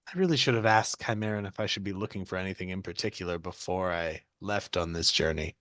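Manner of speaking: low, quick muttered afterthought; soft private grumble, trailing low; quiet aside to oneself; thinking to oneself. Acted. Thinks to self on way out.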